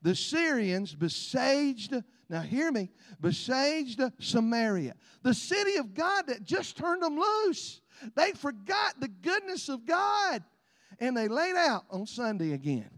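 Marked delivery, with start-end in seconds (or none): 0.0-10.4 preaching. Acted. Preaching slow.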